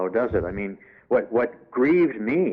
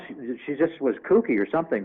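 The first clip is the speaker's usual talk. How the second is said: usual speaking voice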